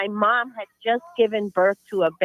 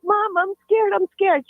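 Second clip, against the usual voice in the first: higher, small frightened voice; mild scared child; 9 semitones higher than usual. Retold or imitated. Child telling mom she is scared, higher. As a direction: sound like a scared kid. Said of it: "And I'm like".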